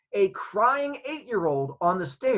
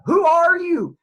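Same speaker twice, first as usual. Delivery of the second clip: loud, high demand; angry interrogating shout; 13 semitones higher, 10 dB louder, slower than usual. Retold or imitated. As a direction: bellow it at the intruder. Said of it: "starts interrogating me on the street".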